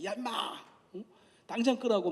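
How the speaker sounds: a mock corporal's yell, rising high; retold yell. Lecturer retelling a corporal yelling at the match-lighter, mock yell. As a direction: bark it like an angry corporal, half-acted. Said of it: "옆에서 소리를 지릅니다".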